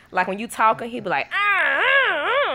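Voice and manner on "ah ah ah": high, mock baby yells; short high wails like a demanding baby; imitated baby scream. Mom copies baby screaming at her, mock scream. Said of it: "He'll scream at you".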